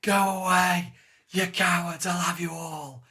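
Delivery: high, drawn-out defiant yell; retold screaming. Downed fighter to the high guard, screaming defiantly. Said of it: "So I just kept screaming".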